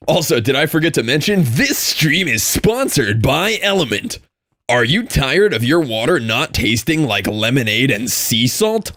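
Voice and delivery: over-the-top YouTuber voice. Acted. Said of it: "That, like, over-the-top YouTuber voice".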